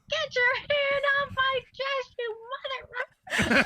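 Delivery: retold shout at a groper, pitched high and loud; mock angry yell. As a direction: throw it out like an outraged woman in a crowd. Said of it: "really angry Robin".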